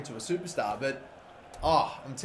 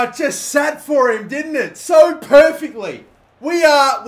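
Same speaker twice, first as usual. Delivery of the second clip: much higher and louder, slowed and stretched; still overjoyed; 9 semitones higher, 11 dB louder, slower than usual. Real, not acted. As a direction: keep riding the goal high, shouting. Still celebrating the goal.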